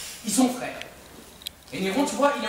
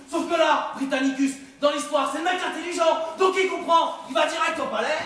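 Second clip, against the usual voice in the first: ordinary talk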